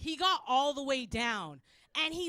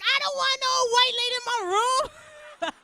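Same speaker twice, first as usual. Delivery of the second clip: high, loud crying wail; freaked-out child; 11 semitones higher, 14 dB louder than usual. Acted. Son terrified of the tooth fairy, freaked-out wail. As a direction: wail it like a panicking little kid. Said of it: "But I freaked him out".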